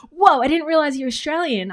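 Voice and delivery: playfully imitating a stunned commenter; mock amazement. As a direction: ham up a viewer's shock. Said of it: "I don't know what you guys expected".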